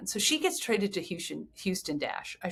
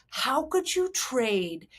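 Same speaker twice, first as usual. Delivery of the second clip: slow, drawn-out, higher mock outrage; outraged fans; 4 semitones higher, much slower than usual. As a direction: stretch it out as an appalled fan's protest. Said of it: "then it went into Lesbianville".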